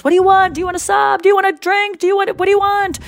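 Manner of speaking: Jerry urgently offering food and drinks, high and shouted; eager host. Retold or imitated. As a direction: fuss over the guests, offering everything. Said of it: "He goes".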